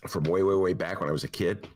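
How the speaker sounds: usual speaking voice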